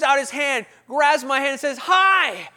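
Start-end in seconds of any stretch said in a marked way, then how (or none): 1.8-2.5 loud, high, booming welcome; hammed-up friendly greeter; overeager church greeter. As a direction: pounce on the newcomer with a big greeting. Eager greeter voiced in story.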